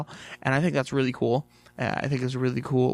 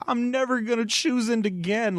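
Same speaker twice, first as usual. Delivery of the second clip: mock wail; 9 semitones higher than usual. Heartbroken utopian wails.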